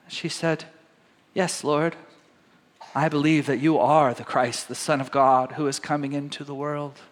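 reads the passage aloud, lifting for the quotes; scripture reading. Acted. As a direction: read it like a Sunday lesson.

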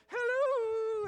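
high, loud, long-held hello; showy preacher's greeting. Retold or imitated. Showy evangelist floating in to greet her audience, hammed-up high greeting. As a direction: float in with a drawn-out, sing-out hello. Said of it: "she would float in like".

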